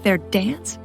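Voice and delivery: ordinary delivery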